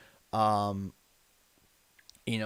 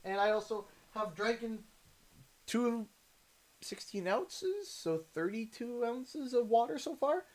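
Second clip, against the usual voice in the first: eating while talking; 10 semitones higher than usual. Real, not acted. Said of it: "don't forget to hydrate and eat".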